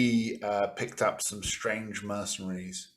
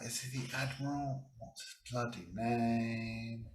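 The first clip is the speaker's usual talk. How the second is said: muttering to himself; 3 semitones higher, 10 dB quieter than usual. Real, not acted. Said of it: "GM muttering over his notes".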